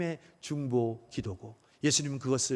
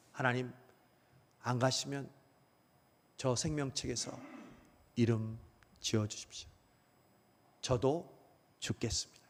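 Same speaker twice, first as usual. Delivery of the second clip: mild hushed plea; 4 semitones lower, 8 dB quieter than usual. Retold or imitated. Moses pleading, voiced by preacher.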